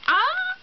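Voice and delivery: very high "ah" of happy surprise; real delighted yelp; surprised squeal. Real, not acted. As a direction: let out a sudden high squeal. Surprise on unwrapping gift.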